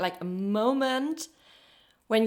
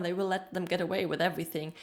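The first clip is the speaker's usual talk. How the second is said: ordinary talk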